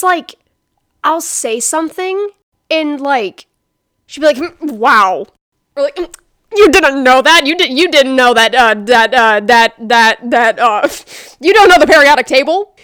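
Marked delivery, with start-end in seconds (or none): 4.6-5.3 mock condescending. Mocking friend's condescension. 6.0-12.7 loud, hammed-up condescending disbelief; loud sneering mock astonishment. Mocking friend's condescending disbelief.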